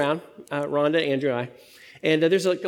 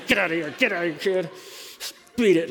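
quick, teasing brush-off; mock shooing; faster than usual. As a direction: playfully shoo them off in a hurry. Jokingly shooing kids away.

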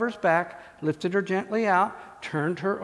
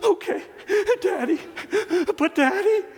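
put-on high, small voice of a little girl; 11 semitones higher than usual. Father imitating his small daughter pleading at bedtime, high little-girl voice. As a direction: pipe it up like a tiny daughter.

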